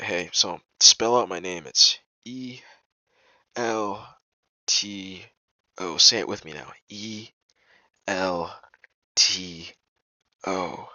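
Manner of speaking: letter by letter, with pauses; slow spelling-out. Retold or imitated. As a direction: imitate him spelling his name slowly for her. Voices Elto spelling out his name.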